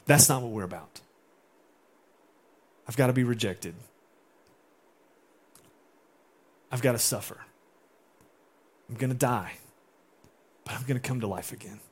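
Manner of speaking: low, subdued, with long pauses; acted solemn declaration. Jesus telling disciples what must come, solemn. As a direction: state each point gravely.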